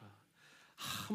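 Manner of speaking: usual speaking voice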